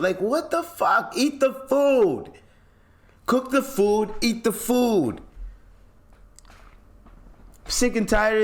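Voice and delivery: high, slow, fed-up yelling; fed-up demand at the chefs; exasperated outburst. Real, not acted.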